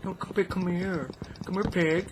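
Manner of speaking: high sing-song call to a pig; coaxing a pig. Pretend. Player calling a pig to come closer, high coaxing.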